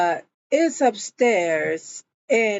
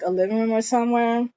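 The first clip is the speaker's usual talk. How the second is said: ordinary talk, nothing marked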